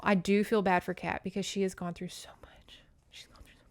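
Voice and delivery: whispered, for Kat. Real, not acted. Vlogger whispering her sympathy for Kat, softly. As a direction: breathe it out as a whisper.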